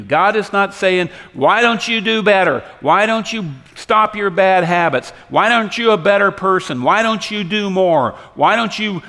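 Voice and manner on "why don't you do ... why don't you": loud, high, badgering questions; mock a nagging voice, loud and relentless; hectoring. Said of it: "God is not harassing and hectoring you".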